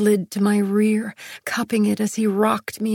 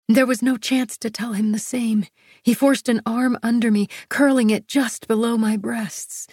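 plain reading voice

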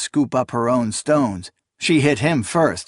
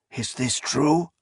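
put-on softer slow old man's question; old priest voice; 4 dB quieter than usual. A narrator's reading. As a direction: ask it like a suspicious elder.

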